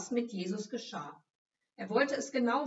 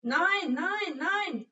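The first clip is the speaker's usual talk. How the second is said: high, loud panicked denial; desperate shouting no; frantic shout; 9 semitones higher, 5 dB louder, much slower than usual. A narrator's reading. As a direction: cry it out in panic. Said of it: "rief Petrus".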